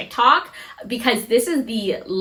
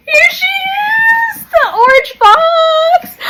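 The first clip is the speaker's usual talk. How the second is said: much higher and louder, voice breaking; excited shout; 7 dB louder, slower than usual. Real, not acted. Vlogger revealing her dream bag's box, shouting tearfully. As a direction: cry out the reveal, close to tears.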